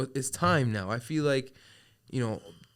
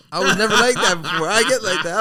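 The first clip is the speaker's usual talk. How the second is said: much higher and louder insistence; emphatic loud; 9 semitones higher, 14 dB louder than usual. Real, not acted. Telling the host she gets like that now, loud. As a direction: push it out loud and high. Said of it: "emphatic self-description".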